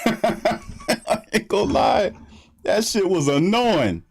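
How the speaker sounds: high, slow laugh through the words; amused, drawn-out complaint. Real, not acted. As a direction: laugh it out in disbelief. Laughing at the annoying week.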